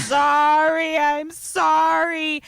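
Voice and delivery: high, loud, very slow wailing sorry; hammed-up crying plea; mock sobbing apology. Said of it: "he's just crying".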